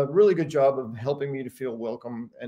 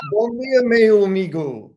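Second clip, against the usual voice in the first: higher and louder, falling away; insistent repeat greeting; 7 semitones higher, 8 dB louder than usual. Retold or imitated. Customer repeating Portuguese for a puzzled merchant, eager loud hail. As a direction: re-enact trying hard to be understood.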